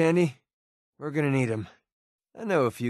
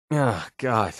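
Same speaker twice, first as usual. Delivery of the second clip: normal talking voice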